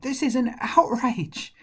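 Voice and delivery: high, loud outraged cry; crying out indignantly. Acted. David, angry at Goliath, cries out high and loud. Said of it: "And he cried out".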